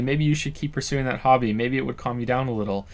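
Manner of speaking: normal talking voice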